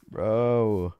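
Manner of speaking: ordinary talk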